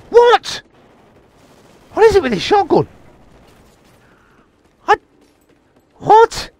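high-pitched incredulous outburst; baffled disbelief. Real, not acted. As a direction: squeak in disbelief at the useless weapon. Baffled by failing shotgun.

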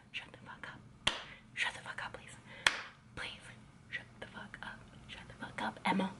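urgent whisper; whispered shush. Pretend. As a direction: hiss it under your breath to silence her. Hissing at Emma to hush.

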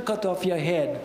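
usual speaking voice

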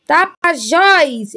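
tapajós with a long, strong JÓS; stress on JÓS. Shown as a demonstration. Teacher classifying words by syllable, tapajós stressed on JÓS. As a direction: lean on the final JÓS of tapajós. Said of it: "é a última sílaba".

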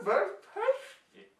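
high, excited squeal of taste; delighted. Acted. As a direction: gush over the flavour. Tasting the mushroom on camera.

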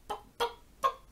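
short, separate popping clucks; three little hen pops; hen clucking. A narrator's reading. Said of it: "And the hen said".

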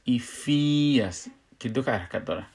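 normal talking voice

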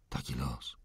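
breathy whispered sigh of fate; whispered lament. A narrator's reading. Girl sighing over her fate, whispered. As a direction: whisper it, resigned. Repeated lament, first take.

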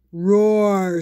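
loud monster roar; deep muffled sea monster. Acted. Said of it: "said the sea monster".

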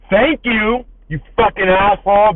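retold sarcastic rebuke. Door holder to man who didn't say thanks, sarcastic rebuke.

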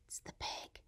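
soft hushed reveal; whisper. Acted. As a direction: whisper it like a secret.

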